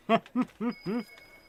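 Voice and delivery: a burst of high loud laughter. Real, not acted. Greeting chat friend.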